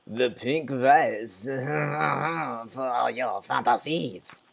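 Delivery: put-on slow, drawn-out French accent with a laugh; hammed-up French temptress. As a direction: purr it slowly like a French seductress. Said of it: "she has a French accent".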